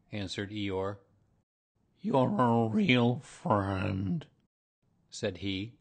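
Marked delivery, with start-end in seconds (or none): 2.0-4.3 very slow, loud, drawn-out donkey voice; gloomy donkey. A narrator's reading. Donkey thanking his helpful bear friend, dolefully. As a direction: stretch every word dolefully.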